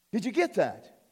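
normal talking voice

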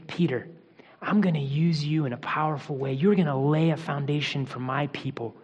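a soft, low, tender promise. A narrator's reading. Jesus promising Peter a future, said softly and tenderly. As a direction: lean in close and reassure him gently. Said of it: "he sort of has this tender moment with Peter".

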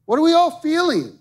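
sudden high, loud cry to the room; preacher shouting a question to the church; preacher's call. Real, not acted. Preacher calls out to congregation.

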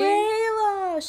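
squealing name. Real, not acted. Calling the dog's name fondly.

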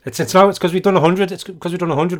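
posh British. Pretend. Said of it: "starting to sound a little bit posher".

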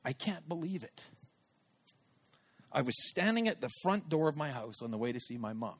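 soft, low, halting disbelief; quietly moved, with long pauses, imitating tears lightly; retold tearful reply. Friend on her way to see her mom, quietly moved. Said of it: "when through tears, she said this".

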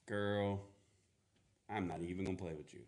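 low, quiet, quick brush-off; dismissive. Retold or imitated. Joseph brushing off Mary's story, dismissive. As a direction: shut it down flatly.

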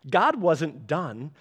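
ordinary talk

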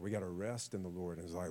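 usual speaking voice

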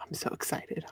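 breathy whisper of mock excitement. Real, not acted. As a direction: whisper it, deadpan thrilled. Sarcastic whisper after fan remark.

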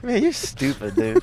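put-on suave old film star. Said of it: "in a nineteen forties voice".

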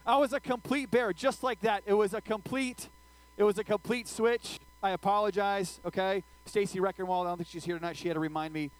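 normal talking voice

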